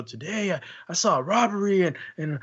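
high, breathless imitated child; excited kid voice. Kid telling parent a wild robbery story, high and excited. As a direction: tell it like an overexcited kid.

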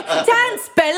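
high mock British-accented cry; mock Billy Elliot accent. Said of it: "This is sounding like Billy Elliot".

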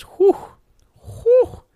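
very high startled whew at the price; whistling whew. Real, not acted. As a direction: gasp at the cost. Reacting to fifty dollar price.

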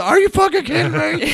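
high, loud, joyful shouting; dad's excited yell. Retold or imitated. Father woken by phone call, shouting with joy. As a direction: burst out yelling in disbelief. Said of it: "straight from dead asleep to".

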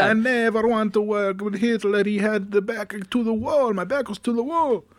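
high-pitched mock Italian voice; Italian accent. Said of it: "That's my Italian voice".

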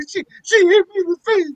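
high, whiny fake sob; hammed-up tearful complaint to police; mock crying. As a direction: play the victim to the police with a mock whimper. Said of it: "I might start crying".

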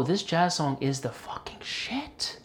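slow, whispered punchline; hushed awe. Retold or imitated. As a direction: drop to an awed whisper, drawing it out. Awed punchline about a jazz song.

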